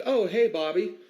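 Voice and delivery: high-pitched imitation of a chummy mother; mock friendly greeting.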